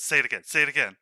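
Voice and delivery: loud mocking bugbear demand; bugbear taunt. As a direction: push the crow to repeat itself, loudly. GM voices bugbear taunting crow.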